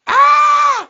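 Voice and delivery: high loud yelp; high loud pain cry; mock pained yelp. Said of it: "he goes".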